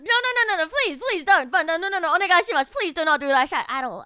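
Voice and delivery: panicked polite begging him not to; flustered refusal. Retold or imitated. Streamer retelling refusing new tea from the agent, flustered and loud. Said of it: "And I'm just like".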